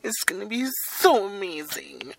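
high, put-on gushing voice; playful hype. Podcaster hyping the next episode at sign-off, playful gushing. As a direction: gush in an exaggerated high singsong.

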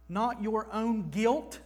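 usual speaking voice

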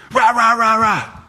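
high-pitched wordless angry squabbling; mock angry noise. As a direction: imitate kids yelling angrily from another room. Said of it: "you hear the anger".

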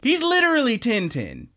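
high, emphatic outburst; can't believe it's him; excited disbelief. Retold or imitated. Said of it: "I was like".

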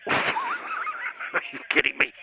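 slow, squeaky laughing question; laughing disbelief. Acted. Caller hearing the league folded, laughing in disbelief.

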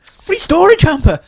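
very high, loud, slow mock awe; thrilled. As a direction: stretch it out in wonder. Echoing the infomercial thrill.

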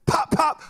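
two high, punched pops; imitated firecracker pops. Comic showing two rockets going off, imitated pops. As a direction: mimic rockets bursting.

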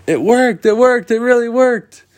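high, loud jubilant cheer; excited shouting. Acted. Children telling the Lost Boys the wish worked, jubilant high shouting. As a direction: cry it out in triumph.

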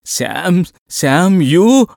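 broken high words between sobs; hiccuping sobs. A narrator's reading. Landlady pointing at the lodger, sobbing his name in gasps. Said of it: "వెక్కిళ్లతో".

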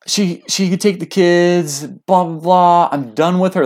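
high, drawn-out mock complaint; slow, whiny-pitched mock outburst; angry dad rant. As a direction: imitate a fed-up husband ranting. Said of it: "I heard something negative".

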